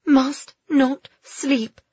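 slow, high, separated words; high girl's voice, word by word; repeated self-urging. Acted. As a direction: force out each word against sleep. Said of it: "she kept saying".

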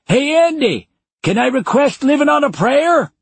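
put-on loud high old man shouting across room.

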